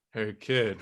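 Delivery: low, soft movie-star impression; Harrison Ford. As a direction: lightly imitate the old actor, low. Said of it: "I don't sound like that".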